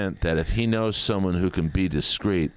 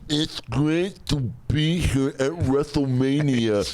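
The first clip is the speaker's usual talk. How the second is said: wrestler promo; 5 semitones higher, slower than usual. Retold or imitated. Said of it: "cutting a promo".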